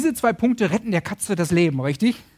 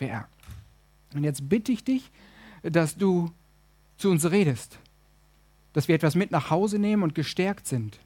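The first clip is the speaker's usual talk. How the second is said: prayer; 3 semitones lower than usual. Real, not acted. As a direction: pray simply with pauses between phrases. Prays aloud for the congregation.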